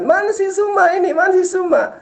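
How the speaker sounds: high mock whine of the opponent; mocking impression. Debater mimicking his rival asking for him, high mocking whine. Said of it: "Terus habis itu dikatakan".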